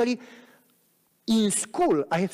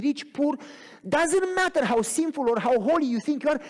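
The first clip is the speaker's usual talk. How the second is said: higher, building sermon cadence; rising preaching; 5 semitones higher than usual. Acted. Preacher rallies the church that God uses anybody, rising. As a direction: lift the congregation with each phrase.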